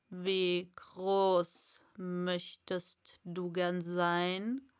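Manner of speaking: low, very slow caterpillar drawl. Acted. Caterpillar questioning Alice, low and very slow. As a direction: ask lazily from the mushroom.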